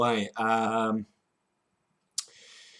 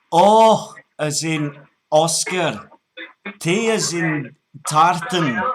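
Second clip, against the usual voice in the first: put-on broad Scottish accent; 4 semitones higher, 6 dB louder than usual. Prank caller spelling for scammer, broad Scottish. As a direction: spell it deliberately as a put-on Scot.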